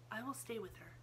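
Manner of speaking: soft half-whisper; Sarah asks Miss Amelia in a hush. A narrator's reading. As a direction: keep it hushed and calm. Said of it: "half-whisper".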